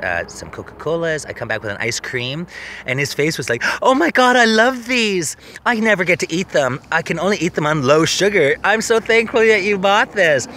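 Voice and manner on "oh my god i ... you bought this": high, loud hammed-up gush over a treat; imitating an overjoyed boyfriend, much higher and louder; gushing delight. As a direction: squeal like a kid handed candy. Boyfriend's delight at ice cream.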